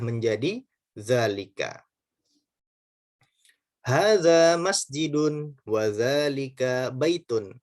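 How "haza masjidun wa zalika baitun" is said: slow, careful Arabic reading for pupils to repeat; slow Arabic drill read; slow model reading. Shown as a demonstration. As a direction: read it out slowly, each syllable clear. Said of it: "Yuk baca sama-sama".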